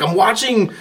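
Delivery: excited high-pitched yell, swooping on stressed words; retold screaming. Fan yelling back at puzzled onlookers about his friend, retold screaming. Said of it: "I'm, like, screaming at the phone".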